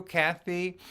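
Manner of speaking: ordinary talk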